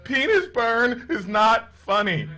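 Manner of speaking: high, slow screaming in pain. Retold or imitated. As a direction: scream it out in agony. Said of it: "came out screaming through the bar".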